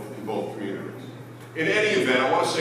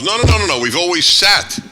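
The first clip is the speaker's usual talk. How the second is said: louder and higher, dead certain; indignant shout; 6 semitones higher, 10 dB louder than usual. Retold or imitated. Rival congregants shout their side in the shul argument, indignant. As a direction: yell it back at the other group.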